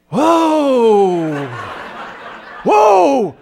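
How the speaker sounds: high, loud, falling "whoa"; exaggerated loud sigh. Husband ignored by wife, hammed-up loud attention-seeking sigh.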